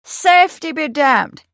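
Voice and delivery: snorting dismissal; scornful snort. A narrator's reading. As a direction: cut in loudly with contempt. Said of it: "Milbank said with a snort".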